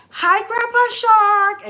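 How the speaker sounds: put-on very high, loud, slow child voice; little girl greeting. Granddaughter greeting his ashes.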